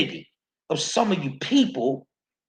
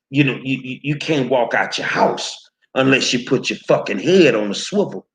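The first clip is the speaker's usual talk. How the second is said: ordinary talk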